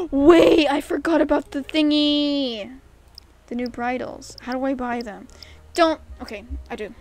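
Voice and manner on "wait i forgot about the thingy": loud, higher-pitched outburst; sudden excited realization. Real, not acted. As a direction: burst out as it suddenly comes back. Suddenly remembers something in game.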